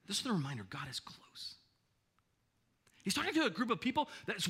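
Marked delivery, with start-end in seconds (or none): 0.1-1.5 low, soft near-whisper; hushed reassurance. Real, not acted. Preacher reminding worriers God is close, hushed. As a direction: drop to a whisper for a tender reminder.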